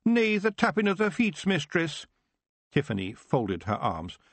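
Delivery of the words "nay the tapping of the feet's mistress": high, fretful Scottish brogue; cowed pictsie pleading; Scots dread. Acted. Rob dreading Tiffany.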